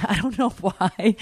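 laughing through the words; real laughter breaking in. Real, not acted. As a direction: still giggling while saying it. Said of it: "I was laughing so hard".

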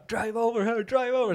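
high excited English yell; high, egging-on mock yell. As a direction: shout them on like a crowd. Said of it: "Och så, så bara".

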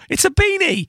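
high-pitched playful insistence; mock protest. Real, not acted. Co-host disputes the guest's word for the hat, mock protest.

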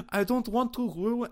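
high, hammed-up French accent; mock French accent. Podcaster retrying others' excuse, exaggerated French accent. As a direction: say it like a silly cartoon Frenchman. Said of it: "I don't know why French".

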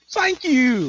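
high, swooping mock-smitten lilt; lullabied, smitten reply. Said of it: "I'm so, like, lullabied by her".

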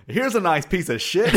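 high, loud film quote, about to laugh; loud mock exclamation. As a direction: belt out the punchline in imitation. Quotes the film's abrupt punchline.

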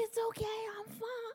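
high, tight, fading reassurance; strained fake calm. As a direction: pretend you're fine through pain. Pretending to be fine in pain.

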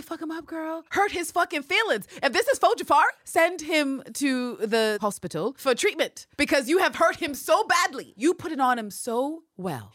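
put-on high, loud hype-girl cheer; high, loud cheering on a friend. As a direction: egg her on, pumped. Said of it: "I say".

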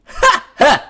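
loud, high burst of laughter; hammed-up guffaw; hearty stage laugh. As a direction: laugh big, as scripted. [name] laughs as script directs.

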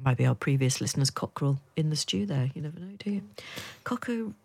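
normal talking voice

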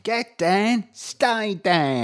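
put-on Welsh Cockney tough-guy accent; Danny Dyer voice. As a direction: growl it like a Welsh Cockney hard man. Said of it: "in a sort of Danny Dyer voice".

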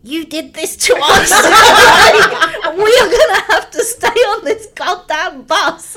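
high, loud, hammed-up accusation; comic outraged rant, breaking into laughter; mock furious friend. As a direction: imitate a friend yelling blame from behind. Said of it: "his voice behind me".

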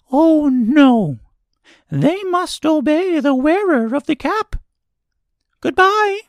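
very high, reassuring mouse queen; squeaky little voice. A narrator's reading. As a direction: reassure her in a tiny squeak. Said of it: "in her squeaky little voice".

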